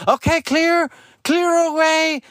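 high, pushy shooing voice; fussy staff voice. Retold or imitated. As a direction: shoo the parents off. Staff clearing parents away.

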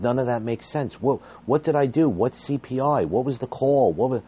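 retold indignant outburst at HR; upset protest. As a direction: fire questions back in shock and outrage. Protesting being fired, upset.

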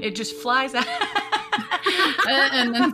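high laughing, embarrassed. Real, not acted. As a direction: laugh it off sheepishly. Laughing at herself.

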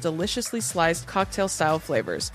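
ad read. Acted. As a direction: sell it like a radio ad. Sponsor ad read.

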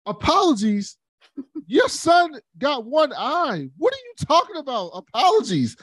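high incredulous mother voice; high put-on disbelief; mock outraged queen. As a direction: hurl it back like an offended mom.

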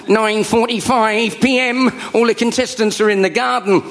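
slow, high put-on voiceover; reality-show narrator. Narrator reporting on housemates, deadpan mock voiceover. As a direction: stretch out the time like a TV announcer.

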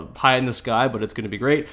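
ordinary talk

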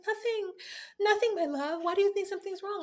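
high, sweet mock innocence; wife brushing off her husband's worry, high and mock sweet; innocent wife. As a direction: play it high and sugary, hiding something. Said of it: "And she's like".

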